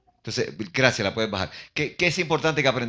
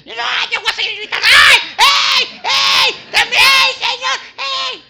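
very high full-force yelling; defiant screaming; 13 dB louder, slower than usual. Acted. Blind man refusing to stop calling the Lord, frantic screaming. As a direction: scream over everyone who hushes you. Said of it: "El tipo estaba gritando".

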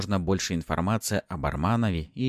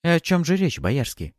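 suspicious; 10 semitones higher, 5 dB louder than usual. A narrator's reading. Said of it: "подозрительно прищурилась".

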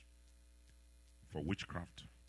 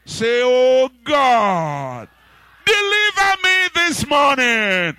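much higher, much louder shouted cry to God; shouted prayer; 12 semitones higher, 22 dB louder, much slower than usual. Acted. Preacher cries to God for deliverance, shouting. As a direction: cry out for deliverance at full force.

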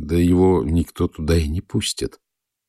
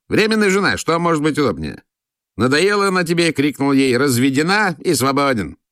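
much higher, jovial and pushy; count's voice; 7 semitones higher than usual. A narrator's reading. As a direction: sell the idea with a leer. Count laughs, pushes temporary wife. (граф, развязно и высоко)

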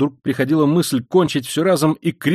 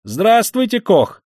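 high, loud friendly hail; cheerful greeting; 9 semitones higher, 5 dB louder, slower than usual. Acted. Young man hails Koch on the stairs, high and cheery. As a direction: greet him with a lift.

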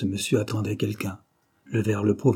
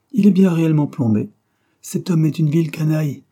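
higher, much louder proclamation; loud declaration; 5 semitones higher, 7 dB louder than usual. A narrator's reading. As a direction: announce it forcefully for the room to hear. Armand proclaims verdict before whole café.

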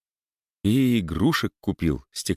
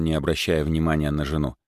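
usual speaking voice

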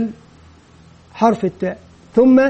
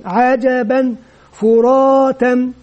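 "فراتا" and "راضية" each drawn out slowly; slow reading; much slower than usual. Shown as a demonstration. Teacher modelling direct reading for pupils, very slow. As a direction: read each word very slowly for beginners. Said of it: "يبدأ ينطق مباشرة".

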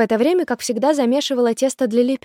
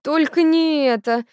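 slow, higher-pitched pleading whine; whining; 6 semitones higher, 3 dB louder, slower than usual. A narrator's reading. Ulka begs mother not to invite the girl, whining. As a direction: drag it out like a whining child. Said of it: "захныкала Улька".

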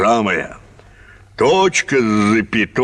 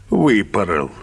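ordinary talk

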